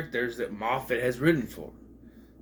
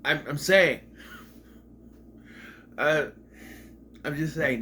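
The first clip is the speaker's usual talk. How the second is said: ordinary talk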